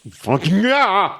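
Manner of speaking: high, slow, laughing groan of pain; strained laughing groan. Retold or imitated. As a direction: stretch it out, half groaning, half laughing. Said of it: "I'd be like".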